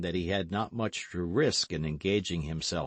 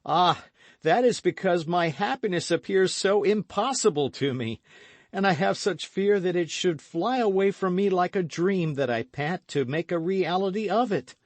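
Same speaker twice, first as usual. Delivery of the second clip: high, loud, breathless with passion; passionate plea; 12 semitones higher, 6 dB louder than usual. Acted. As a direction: pour out a lover's longing. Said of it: "carried away by the passion".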